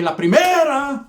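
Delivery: high, loud, slow gloating; cocky first-punch call. Retold or imitated. Kid announcing his first punch, high slow loud gloat. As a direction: savor calling the first hit.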